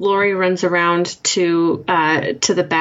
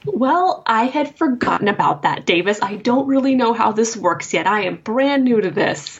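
higher, defensive newcomer voice; higher, flustered character voice; flustered Lori; 6 semitones higher than usual. Acted. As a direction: play Lori caught out and defensive.

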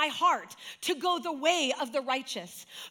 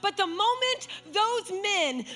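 preaching at full force; 4 semitones higher than usual. Acted. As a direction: build to a fervent climax. Preaching climax over music.

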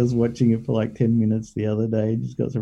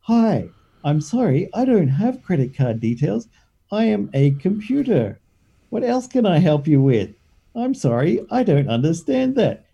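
lightly mocked smart speaker; imitating Alexa; 7 semitones higher than usual. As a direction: answer like a patient voice assistant. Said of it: "she does voices similar to Alexa".

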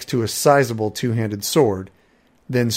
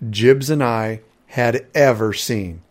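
ordinary delivery, nothing marked

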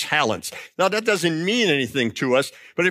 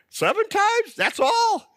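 much higher, mock-astonished Jesus; Jesus surprised; slower than usual. Jesus answering Peter's seven, in high mock disbelief. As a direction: toss it back as if it's far too few. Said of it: "Jesus came right back".

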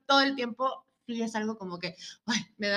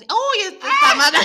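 high, loud, drawn-out mock amazement; slow, showy comic exclamation; playful contest excitement; 10 semitones higher, 11 dB louder than usual. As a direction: marvel through laughter. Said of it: "hago competencias".

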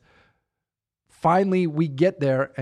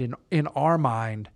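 ordinary talk, nothing marked

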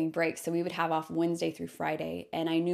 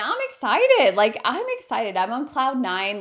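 high, loud burst of excitement; excited; 6 semitones higher, 7 dB louder than usual. Retold or imitated. Traveler planning the trip again, thrilled and excited. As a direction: squeal it, then keep gushing.

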